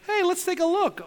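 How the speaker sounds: high, breezy, offhand voice; casual mock Jesus. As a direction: toss it off like it's no big deal. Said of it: "he's like".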